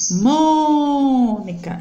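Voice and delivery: "mônica" with a long, drawn-out MÔ; stressed syllable. Shown as a demonstration. Teacher showing the tonic syllable, "mônica" held on MÔ. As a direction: hold and push the first syllable of "mônica". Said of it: "é aquela que eu vou falar com mais força".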